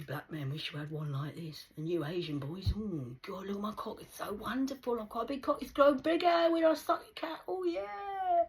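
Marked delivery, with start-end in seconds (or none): 2.8-8.4 hammed-up rapture, rising to a high finish; mock ecstatic gushing. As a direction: gush with mounting mock delight. Acting out mock sexual boasting.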